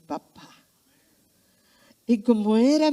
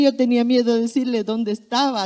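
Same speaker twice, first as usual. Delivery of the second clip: usual speaking voice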